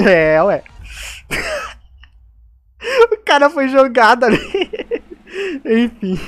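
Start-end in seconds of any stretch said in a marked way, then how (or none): 0.7-1.7 high breathless wheeze-laugh; wheezy silent laugh. Real, not acted. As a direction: let out a soundless giggle. Wheezing laugh after pushing. (risada sem som, ofegante)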